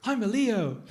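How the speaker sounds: high, loud comic answer; mock eager suitor. Smitten man answering his blind date, hammed-up loud, high voice. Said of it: "But then he answers".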